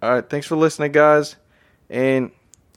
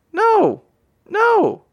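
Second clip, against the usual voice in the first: emphatic no. Real, not acted. Answers his own bicycle question.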